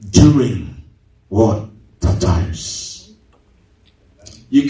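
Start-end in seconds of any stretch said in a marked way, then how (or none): none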